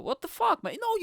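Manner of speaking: high, loud mock outrage; hammed-up outraged squeal. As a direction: shriek it in disbelief. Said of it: "they're like the Tinder girl".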